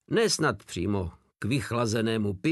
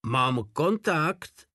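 drawn-out, higher, mild cry; called out; 5 semitones higher, much slower than usual. A narrator's reading. Radio operator tells the squad, mildly called out. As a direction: announce it in a slow, raised voice. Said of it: "vykřikl Hajde".

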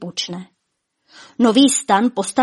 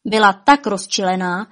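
ordinary delivery

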